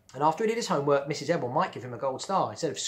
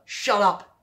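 higher, louder acted yell; 8 semitones higher, 9 dB louder than usual. Bradley to Laurie, a loud acted yell. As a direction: bark it at the girls. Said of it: "he yelled".

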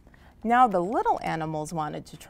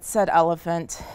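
plain reading voice

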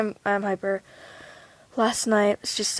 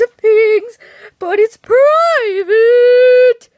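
mock wail; 11 semitones higher, 10 dB louder, much slower than usual.

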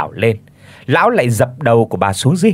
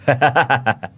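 mad laugh. A narrator's reading. Boy trails off laughing madly beside the body. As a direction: let a crazed laugh trail off. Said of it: "cười hành hạch từng tràng man dại".

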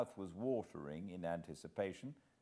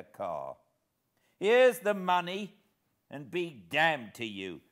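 loud, higher, resentful rant; surly outburst; 6 semitones higher, 10 dB louder than usual. Acted. Hero acting a surly tramp, loud resentful rant. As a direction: bark it like a cornered ruffian. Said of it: "I put on my surliest face".

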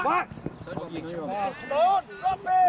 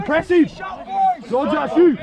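shouting; 3 semitones higher, 8 dB louder, slower than usual. Real, not acted. Coach urging players.

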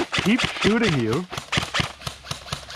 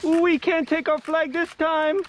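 excited whoop; 11 semitones higher than usual. Real, not acted. Celebrating defending the flag.

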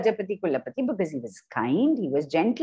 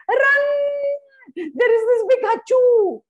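much higher, louder panicked call; alarmed shout; 14 semitones higher, 6 dB louder than usual. Shown as a demonstration. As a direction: yell a warning as the banana seller. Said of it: "you can make all those sounds".

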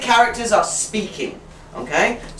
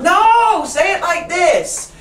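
put-on bossy child; 10 semitones higher, much slower than usual. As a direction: imitate a pushy kid correcting a classmate, pitched way up. Confident kid bossing the shy ones.

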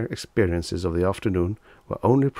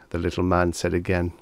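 ordinary delivery, nothing marked

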